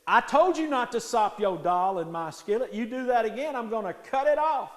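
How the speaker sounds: loud, high-pitched angry scolding; angry threat. Acted. Jack threatens the cat with his knife, shouted anger. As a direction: lay down a furious last warning.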